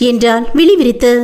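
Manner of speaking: ordinary delivery, nothing marked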